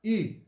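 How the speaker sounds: I said with rounded lips, the French u; pursed-lip u sound. Shown as a demonstration. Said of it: "com um biquinho". (I com biquinho, som do u francês)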